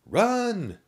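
shouted cheer, high and loud; yelled. A narrator's reading. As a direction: yell it across the field. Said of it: "Skinny Zombie's friends yelled".

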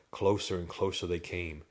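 plain reading voice

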